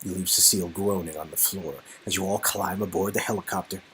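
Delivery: ordinary talk, nothing marked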